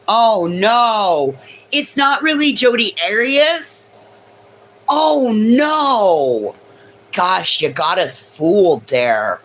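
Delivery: high hammed-up gasping dismay; mock shock. Mock horror at fans learning she's not Jodi. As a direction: ham up the shocked disbelief, high and loud.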